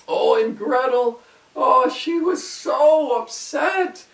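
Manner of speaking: slow, high, loud sorrowful telling; dramatic narration. A narrator's reading. Narrator tells of Gretel's grief, slow and high. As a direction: stretch out her distress.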